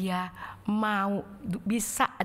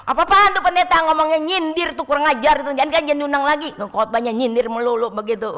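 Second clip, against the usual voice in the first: much higher, louder mock outrage; indignant griping; 5 semitones higher, 11 dB louder than usual. Family at breakfast complaining about the pastor, loud mock outrage. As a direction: rant about the preacher like a sore churchgoer.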